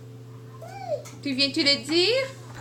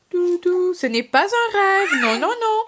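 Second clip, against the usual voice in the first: higher, louder thrilled exclamations; gleeful excitement; 5 semitones higher, 5 dB louder, slower than usual. Real, not acted. Mom gushes to viewers about raw cookie dough, higher and louder. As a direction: gush about the treat.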